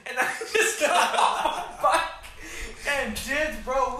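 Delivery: high, loud and slow through laughter; laughing. Real, not acted. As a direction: giggle it out as you recall running. Laughing while telling the escape.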